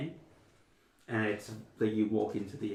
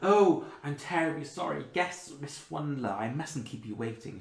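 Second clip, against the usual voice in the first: apologetic elf receptionist; 5 semitones higher, 5 dB louder than usual. Acted. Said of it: "she goes".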